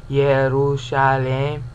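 "Yerushalem" said slowly with a y sound; Hebrew-style Yerushalem; Hebraistic pronunciation. Shown as a demonstration. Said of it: "because it's in Jerusalem".

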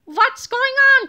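high, slow, loud little voice; small villain calling from the pocket; tiny double calling from a pocket; muffled tiny cry. A narrator's reading. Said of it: "cried Tiny Tippy".

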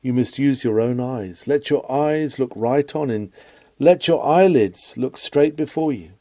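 ordinary delivery

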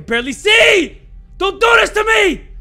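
high, loud scared yell; panicked shouting. Real, not acted. Player pleading in the dark, shouting in panic. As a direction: plead at full volume.

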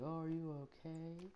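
high, hushed mock-concerned coach voice; imitated coach in dismayed, soft high tones; worried coach. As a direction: softly lament over an injured kid, high-pitched. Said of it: "He starts like".

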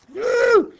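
sudden high yelp of fun; playful high woo. Real, not acted. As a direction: let out a high whoop. Pen slipping on screen.

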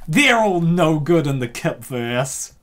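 normal talking voice

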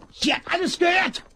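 high, loud, urgent cry to give chase; alarmed shout. Acted. Shouting to his partner to chase the eavesdropper. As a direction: bark the order to go after her. Spots eavesdropper, orders pursuit.

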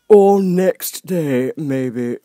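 high, slow, lofty footman voice; frog footman. Acted. Frog footman dismissing Alice at the door, lofty. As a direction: talk down to her from on high. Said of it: "in the same tone".

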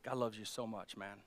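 soft, slowing, voice choked with tears; telling a grieving man God loves him, tearfully. Retold or imitated. Pastor comforting Frank, softly tearful. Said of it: "tears welled up in my eyes".

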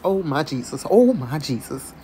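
ordinary delivery

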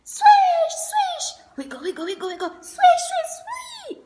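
high squeaky swish noises; playful swishing sounds. Acted. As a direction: play the fish swimming sounds. Playful fish sound effects.

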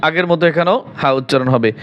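usual speaking voice

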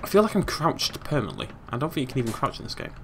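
ordinary delivery

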